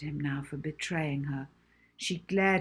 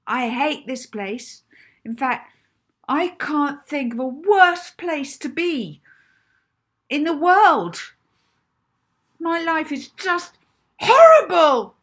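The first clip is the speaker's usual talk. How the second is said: slow, very loud, high desperate yell; 10 semitones higher, 14 dB louder, slower than usual. Acted. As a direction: yell it out building to a wail. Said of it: "she yelled".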